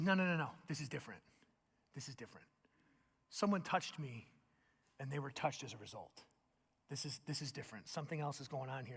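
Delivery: soft, low, intent; hushed Jesus. Acted. Jesus quietly insists to Peter this is different. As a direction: draw them in, quietly.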